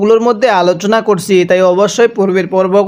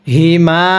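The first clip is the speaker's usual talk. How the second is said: usual speaking voice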